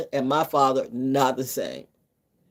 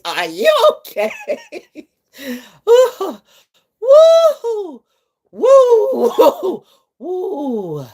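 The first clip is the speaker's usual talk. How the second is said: much higher, hooting laugh; 4 dB louder, much slower than usual. Real, not acted. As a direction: hoot with laughter. Laughing fit.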